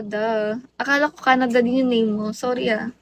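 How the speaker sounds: ordinary delivery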